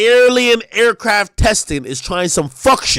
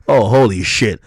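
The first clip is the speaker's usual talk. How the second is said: normal talking voice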